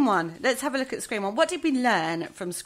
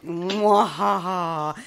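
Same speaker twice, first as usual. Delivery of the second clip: wild unhinged ranting; 3 semitones lower, 3 dB louder, much slower than usual. Pretend. Host's evil laugh after horror clips.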